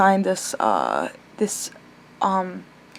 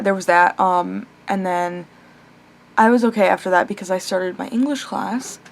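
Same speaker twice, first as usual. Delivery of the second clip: ordinary talk, nothing marked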